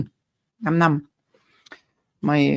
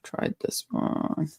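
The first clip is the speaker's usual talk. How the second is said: whispered trail-off; 10 dB quieter, slower than usual. Real, not acted.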